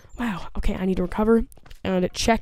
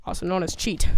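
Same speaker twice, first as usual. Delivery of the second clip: ordinary delivery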